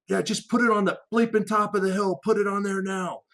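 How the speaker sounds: high, strained shout of a man being overrun; desperate radio yell. Retold or imitated. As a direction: yell it like your life depends on it. JTAC insists on danger close.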